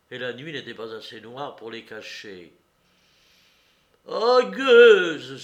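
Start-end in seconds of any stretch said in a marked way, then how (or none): 4.1-5.4 very loud, high outburst of rage; furious cry. A narrator's reading. Courrapier insults the woman who tricked him, enraged. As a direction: explode at her. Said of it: "emporté par la colère".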